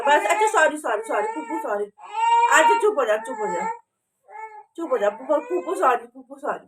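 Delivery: calm the sobbing little one down, sorry after sorry. Real, not acted. Soothing her crying little girl.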